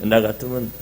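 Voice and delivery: normal talking voice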